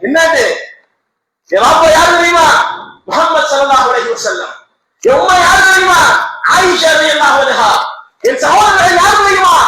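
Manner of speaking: put-on high, loud shouts of a delighted child; child running and shouting his news, elated; joyful shout. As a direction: cry it out to the whole street with joy. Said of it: "கத்திக்கிட்டே ஓடுறாங்க குழந்தை".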